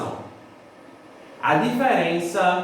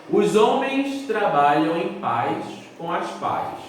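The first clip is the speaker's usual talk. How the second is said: Os homens trabalham em paz com as pais read slowly for copying; slow dictation; slower than usual. Shown as a demonstration. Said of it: "Vou repetir". (ditado lento e claro)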